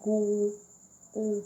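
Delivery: "गो" with a long held O, then "ओ" alone; drawn-out O vowel. Shown as a demonstration. Teacher shows students the vowel in गो, drawn-out O. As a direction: stretch the O in "गो", then isolate "ओ". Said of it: "ओ करके यहाँ vowel निकल रहा है".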